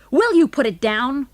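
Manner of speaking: loud, high, panicked demand; sudden panic. A narrator's reading. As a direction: beg him loudly to stop. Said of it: "cried Mister Henning in a sudden panic".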